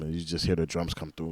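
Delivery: ordinary talk, nothing marked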